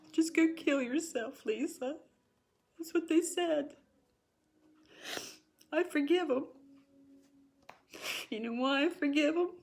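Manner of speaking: high, tearful voice; hurt and tearful, pitch pushed right up; real crying. Real, not acted. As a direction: forgive them through real tears. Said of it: "So if seeing me cry".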